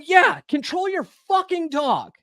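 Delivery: high, slow, strained angry retort; retold yelling. Man snapping at his neighbor about her dog, retold yell. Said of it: "I'm yelling at her".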